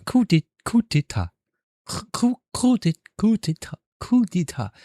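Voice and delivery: "coup d'état" attempted high, French-style; practised French pronunciation. Streamer practising after the French tutor, French-style "coup d'état".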